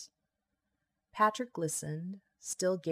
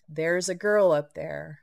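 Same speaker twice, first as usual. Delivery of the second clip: repeated insistence; 9 dB louder than usual. A narrator's reading. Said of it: "she repeated".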